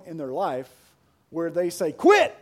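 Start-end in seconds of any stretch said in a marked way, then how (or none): 1.9-2.3 put-on loud, high yelp of a kid refusing help; imitating a kid's sharp protest; protesting child. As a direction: blurt it like an independent kid. Child protesting help, voiced in story.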